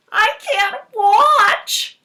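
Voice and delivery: high, loud and slow hammed-up dread; mock horror. Teacher hamming up fear over the book's blurb, high and loud. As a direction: squeal it like you can't bear to look.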